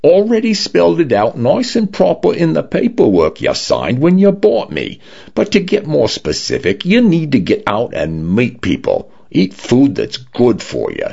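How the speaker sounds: preachy Cockney home lecturing its owner; a light Cockney voice, stern and bossy; lecturing Cockney condo. A narrator's reading.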